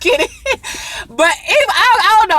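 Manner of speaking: high, loud laugh. Real, not acted. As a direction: giggle through the words. Laughing at own joke.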